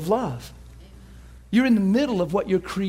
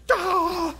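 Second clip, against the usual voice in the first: high, drawn-out "ah" of joy; ecstatic cry; 11 semitones higher than usual. Retold or imitated. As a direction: let out a long overjoyed cry. Acts out overflowing joy in truck.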